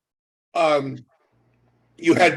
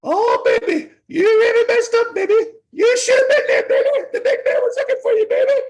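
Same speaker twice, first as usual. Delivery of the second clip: high, loud squealing manager voice with laughs; Jimmy Hart; 3 dB louder than usual. Retold or imitated. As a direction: shriek it like a hyped wrestling manager, laughing. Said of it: "Jimmy Hart, who I love to death".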